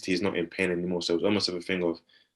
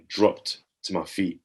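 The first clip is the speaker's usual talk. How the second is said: ordinary talk, nothing marked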